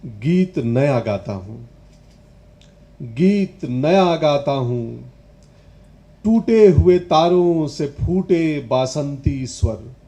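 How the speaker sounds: loud, slow, weighty recital; ringing stage recitation of a poem; declaimed poetry. Acted. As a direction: project each phrase of verse, drawing out the key words.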